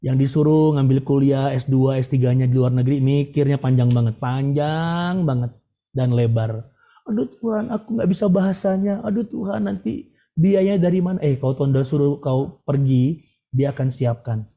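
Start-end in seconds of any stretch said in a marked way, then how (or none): 3.0-6.7 "panjang banget" dragged slowly for comic length; drawn-out emphasis. Real, not acted. Drawing out how long they overthink. 7.1-11.2 higher-pitched mock worrying; high, fretful imitation; fretting worrier. Voicing an overthinker fretting to God.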